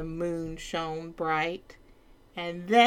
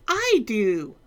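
eager duke; 4 semitones higher, 7 dB louder than usual. Acted. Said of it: "cried the duke".

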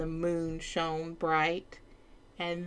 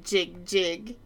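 usual speaking voice

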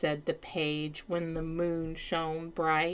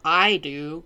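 louder, confident knight; bold knight; 7 dB louder than usual. Acted. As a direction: step up proudly as the knight. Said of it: "cried the knight".

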